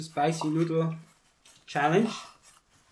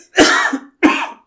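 coughing; 13 dB louder than usual. Real, not acted. Coughing from spicy noodles.

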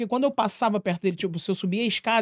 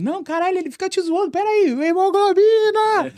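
mocking protest; 11 semitones higher, 5 dB louder than usual. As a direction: egg on the teasing, ending in a jeer. Kids refusing to stop teasing.

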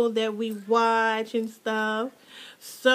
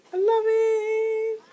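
high, slow gush; 10 semitones higher, slower than usual. Real, not acted. Showing keychain.

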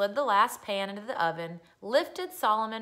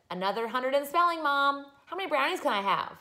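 put-on higher, quick child voice; eager young boy; 7 semitones higher, 4 dB louder, faster than usual. Boy telling Mom his spelling score, eager child voice. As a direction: rattle it off eagerly, pitched up.

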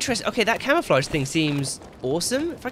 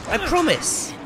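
high sing-song promise; mock promise; 9 semitones higher, slower than usual. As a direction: tease it. Taunting a zombie.

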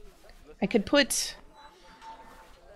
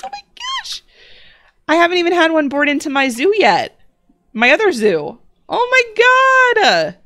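thrilled and gushing; 5 semitones higher than usual. Real, not acted. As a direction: gush over a rare find, fully delighted. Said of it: "way more excited than I need to be".

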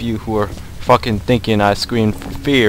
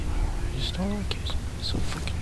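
nervous whisper; 8 semitones higher, 7 dB quieter than usual. Real, not acted. Gamer whispering his unease alone in the dark. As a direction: breathe it out, not wanting to be heard.

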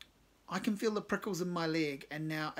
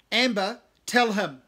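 loud order; 5 semitones higher, 12 dB louder, slower than usual. Acted. Cave ordering singer.

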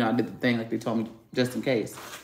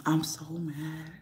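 slow, soft and choked up; holding back tears while admitting the anger; upset, near tears; 3 semitones higher, 8 dB quieter, slower than usual. Real, not acted. Alone in the garage, venting to viewers, slow and softly upset. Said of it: "I was so mad that I started crying".

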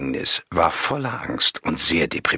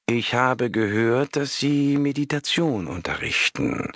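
a caller drawling down the phone; drawled; 7 semitones higher than usual. A narrator's reading. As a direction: stretch each word lazily. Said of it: "sprach sie gedehnt".

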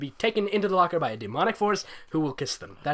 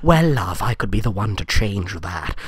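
Gandalf-like voice; 9 semitones lower, 4 dB louder than usual. Acted. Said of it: "he's like Gandalf".

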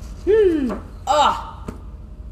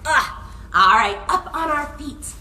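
usual speaking voice

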